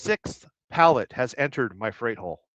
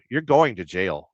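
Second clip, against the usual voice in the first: ordinary delivery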